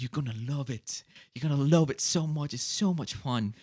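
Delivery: gushing imitation of an English girl; mock English accent. English stripper talks up England to her customer, gushing mock English accent. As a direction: gush along in a put-on English accent. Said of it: "like, this English accent".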